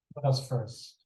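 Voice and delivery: usual speaking voice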